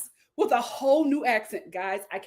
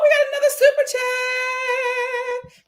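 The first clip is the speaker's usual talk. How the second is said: sing-song announcement; 12 semitones higher than usual. Real, not acted. Announcing a new super chat.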